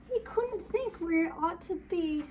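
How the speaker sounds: usual speaking voice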